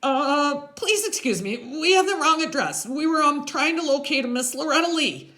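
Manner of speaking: put-on high, loud, formal kid voice; high-pitched kid making excuses; nervous polite kid. A narrator's reading. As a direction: pipe up politely in a high little voice. Voices Freak in story.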